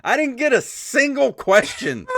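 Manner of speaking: high, loud exasperated rant; mock outrage. Real, not acted. Host complains nobody sent him questions, exasperated. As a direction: punch it out, high and indignant.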